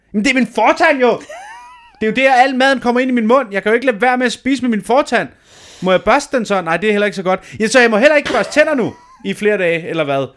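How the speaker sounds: upset patient arguing with the emergency dentist, exasperated; exasperated outburst. Retold or imitated. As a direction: complain at the dentist in disbelief. Retold frustration at dentist's advice.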